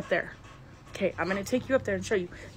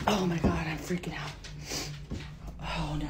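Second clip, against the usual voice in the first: frightened hush; 3 semitones lower, 7 dB quieter than usual. Real, not acted. Reacting to the footsteps.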